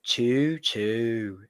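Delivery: drawn-out, bored choo choo; disinterested. Retold or imitated. Guest mimicking a ride operator's announcement, disinterested. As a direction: draw it out slowly, sounding uninterested. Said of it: "in that kind of disinterested voice".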